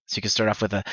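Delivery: usual speaking voice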